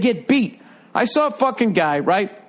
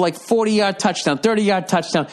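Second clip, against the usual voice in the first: ordinary talk, nothing marked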